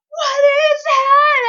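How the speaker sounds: high, loud, slow frightened cry; panicked shriek. Retold or imitated. On the porch with her brother-in-law, panicked high shriek.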